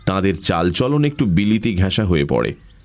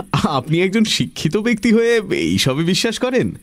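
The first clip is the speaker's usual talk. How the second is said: much higher voice, laughing disbelief; amused, light scoffing; 11 semitones higher than usual. Acted. Young Pramatha teasing an educated man's belief, high amused scoffing. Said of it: "Pramatha হেসে উঠল".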